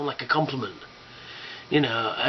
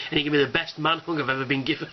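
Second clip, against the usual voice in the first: ordinary talk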